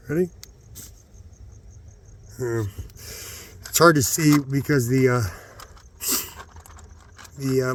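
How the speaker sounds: usual speaking voice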